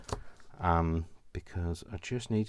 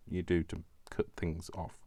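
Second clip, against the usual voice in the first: usual speaking voice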